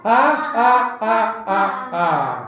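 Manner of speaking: acted "ha ha" burst, dropping at the end; punchy mock laugh in even beats; crowd's laughter. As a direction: imitate everyone bursting out laughing. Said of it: "સૌ લોકો હસી પડ્યા".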